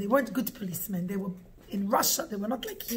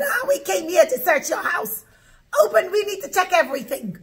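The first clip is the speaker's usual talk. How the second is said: loud, high demanding shout; police bellowing; 7 semitones higher, 7 dB louder than usual. Acted. Police come to search the house, bellowing. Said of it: "they start to scream and to bellow".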